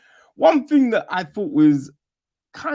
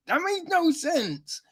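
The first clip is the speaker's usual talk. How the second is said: That makes no sense said high and falling; pitched up; 12 semitones higher, 3 dB quieter than usual. Shown as a demonstration. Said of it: "Yo, that makes no sense".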